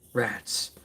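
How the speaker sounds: a small unvoiced whisper of disappointment; whispered rats. Toy reviewer missing an accessory, whispers rats. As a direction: breathe it out, no voice.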